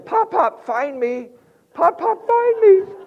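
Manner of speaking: put-on high, loud little boy calling; toddler calling. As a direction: call out like an excited three-year-old. Preacher voices toddler grandson.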